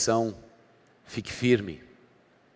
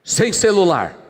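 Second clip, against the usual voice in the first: acted stern parent, high and loud; loud, high-pitched scolding parent; strict parent; 9 semitones higher, 8 dB louder than usual. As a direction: burst out with a loud parental ban. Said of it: "Às vezes os pais são assim".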